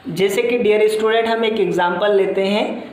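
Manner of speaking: usual speaking voice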